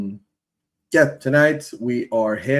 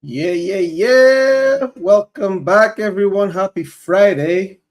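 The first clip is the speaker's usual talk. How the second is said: hoarse, strained opener, louder; 7 semitones higher, 6 dB louder, slower than usual. Real, not acted. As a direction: voice gone hoarse, shouting anyway. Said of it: "my voice is gone a little bit".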